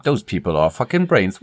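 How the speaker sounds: usual speaking voice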